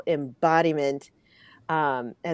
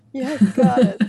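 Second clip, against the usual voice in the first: loud, high, laughing affirmation; delighted cheering; 6 semitones higher, 8 dB louder than usual. Real, not acted. Host cheering on her guest's insight, delighted and laughing.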